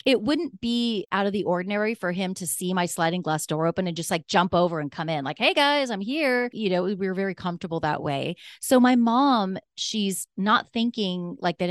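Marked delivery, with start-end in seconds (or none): 5.4-6.5 high, breezy hello; imitated cheery drop-in greeting; boyfriend's casual greeting. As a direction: announce yourself to the household. Boyfriend casually arriving, acted greeting.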